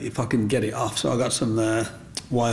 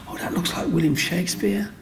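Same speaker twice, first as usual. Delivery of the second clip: excited woman; 8 semitones higher than usual. Retold or imitated. Woman from across road.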